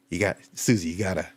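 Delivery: a hint of a made-up doctor coaching; fake doctor voice. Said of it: "This is my fake voice for him".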